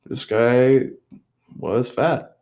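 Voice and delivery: hammed-up pondering scientist; mock scientist. Mock scientist studying his donated body, musing aloud. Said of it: "they could sit there and say".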